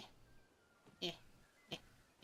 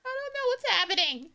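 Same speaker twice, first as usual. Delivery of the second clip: flustered; 14 semitones higher, 6 dB louder than usual. Real, not acted. Flustered streamer, high voice.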